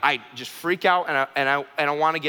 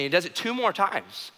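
usual speaking voice

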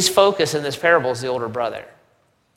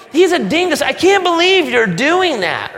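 put-on whiny older brother; 11 semitones higher, 5 dB louder than usual. Brother mocks younger brother.

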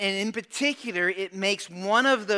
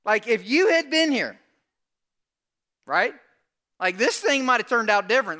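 loud, high, pushy complaint; acted-out accusation, voice raised; aggressive Martha; 4 semitones higher, 5 dB louder than usual. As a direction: confront him with it, tenacious and insistent. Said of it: "She's aggressive".

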